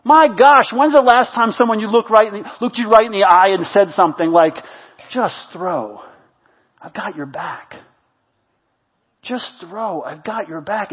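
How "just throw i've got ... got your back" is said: very soft, low encouragement; intimate reassurance. Acted. Someone looking you right in the eye, soft and reassuring. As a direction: say it quietly, leaving space after each phrase.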